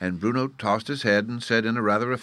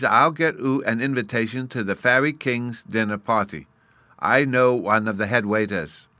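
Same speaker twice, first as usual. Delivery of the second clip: put-on loud, slightly raised childlike voice; little fairy boy, self-important; 3 semitones higher, 5 dB louder than usual. Bruno offers the narrator a dinner invitation, self-important little fairy. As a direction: boast like a small boy with connections.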